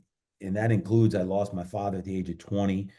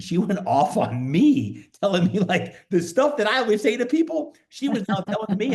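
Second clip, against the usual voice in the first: excited retelling; 13 semitones higher, 5 dB louder than usual. Real, not acted. Telling the host how his wife turned his words back on him, excited and high. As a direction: voice jumps high and loud, laughing in.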